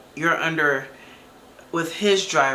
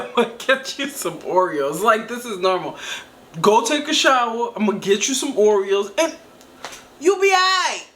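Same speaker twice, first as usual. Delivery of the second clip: mocking him; 3 semitones higher than usual. Mocking his voice, laughing.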